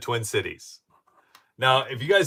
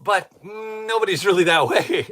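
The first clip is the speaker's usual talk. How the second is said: retold inner thought; 12 semitones higher, 11 dB louder than usual. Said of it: "And I'm going".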